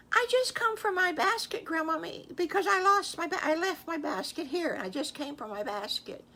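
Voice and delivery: put-on a sweet child explaining why she came back; little girl voice.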